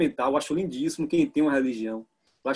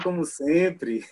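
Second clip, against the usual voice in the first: normal talking voice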